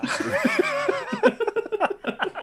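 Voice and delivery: long, high-pitched burst of laughter; real drawn-out cackle of amusement; laughing. Real, not acted. Joking jab at Mini Mac lands.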